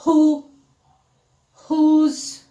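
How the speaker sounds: emphatic H at the start of "Who" and "whose". Shown as a demonstration. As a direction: model "Who", "whose" with a clear H sound. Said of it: "têm o mesmo som".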